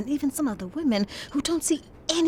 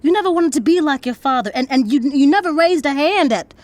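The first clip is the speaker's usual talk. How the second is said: insistent, raised voice pleading her case; heated confrontation; 5 semitones higher, 5 dB louder than usual. Acted. Daughter confronts father about violence, heated. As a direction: challenge him, louder and higher as it builds.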